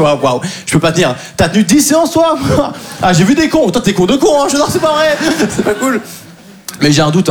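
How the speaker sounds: ordinary delivery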